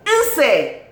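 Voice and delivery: high, falling cry of admiration; emphatic admiring shout; exclaimed name. Real, not acted. Calling out favourite actress's name.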